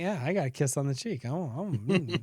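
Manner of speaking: low, soft mock-smug murmur; acted bashful self-satisfaction; quietly pleased. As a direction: savour the kiss quietly. Gloats softly about wife's kiss.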